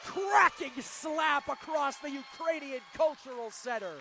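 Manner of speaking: slow, loud ringside call; excited shout. Real, not acted. Commentator relaying a slap across the hall, excited shout. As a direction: stretch each word out loudly.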